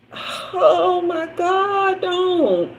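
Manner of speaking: high, choked voice on the edge of crying; fighting back tears, pitch way up; overwhelmed and about to cry for real; near tears. Real, not acted. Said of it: "look, I'm not gonna cry".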